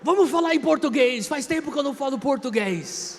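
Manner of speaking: put-on an overjoyed priest hearing his old language; delighted priest. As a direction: priest exclaiming in delight at a Brazilian. Priest thrilled to meet a Brazilian.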